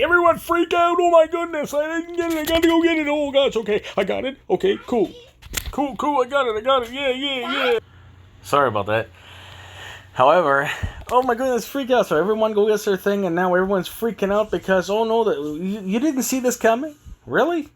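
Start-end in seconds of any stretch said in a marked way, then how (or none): 0.0-7.8 very high, squeaky frantic mockery; hammed-up freak-out, way up in falsetto; mock a flustered panicker in a piercing high voice; panicked crowd. Mocking a panicked crowd rushing to comply. 11.1-17.7 hammed-up fretting over everyone freaking out; pitched-up incredulous mimicry; mock panic. Mocking everyone freaking out again.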